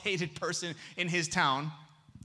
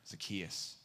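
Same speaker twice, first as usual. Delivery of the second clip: very soft, low, loving call of the name Zacchaeus; said with love; 10 semitones lower, 11 dB quieter than usual. Shown as a demonstration. Pastor showing how Jesus said the name with love. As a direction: breathe the name gently, full of love. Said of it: "said in a tone of love".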